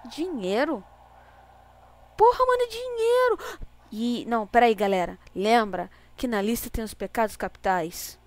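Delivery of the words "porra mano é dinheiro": high, loud excited yell; excited shout. Real, not acted. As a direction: shout it out in sudden delight. Finds money raining in game.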